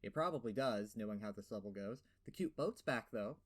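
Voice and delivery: ordinary talk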